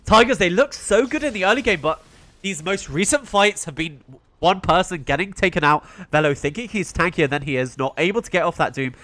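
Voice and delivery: normal talking voice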